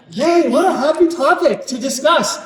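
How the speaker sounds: high mock cheer through a laugh; sarcastic cheer. Speaker jokes to the audience about the grim topic, mock cheerful. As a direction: fake delight, laughing at the irony.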